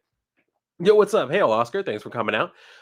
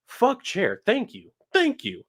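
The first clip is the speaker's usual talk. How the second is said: gleeful cheer; 7 semitones higher, slower than usual. Retold or imitated. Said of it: "Kexi's the one like".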